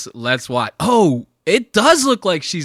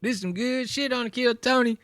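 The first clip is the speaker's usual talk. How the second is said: mock Texas twang, pitched up; Texas crowd accent; 11 semitones higher than usual. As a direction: imitate a delighted Texan spectator. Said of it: "You know how they talk down there in Texas".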